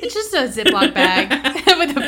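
loud, high, through laughter; words breaking into big laughs; laughing hard. Real, not acted. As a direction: crack up while saying it. Cracking up over the doll.

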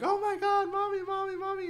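put-on high, panicked little kid wailing for mommy; crying kids. Kid sees mom on the floor, high panicked wail. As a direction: squeal mommy like a scared child. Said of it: "the kids walk in and they're crying".

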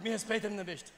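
playful macho scolding; mock macho. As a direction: put on the tough man, firm and loud. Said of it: "Eu dou uma machão".

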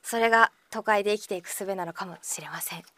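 hushed whisper of false wisdom; mock solemn whisper. Host giving fake city survival advice, hushed whisper. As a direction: whisper it like a grave secret.

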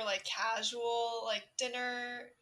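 slow, drawn-out delivery; slow talking. Real, not acted. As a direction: stretch each word out slowly. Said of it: "he is making fun of my talking speed".

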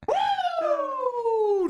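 high excited whoop; whooping cheer. Real, not acted. Fan whoops for his team, high excited cheer. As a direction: let out a big happy woo for the team.

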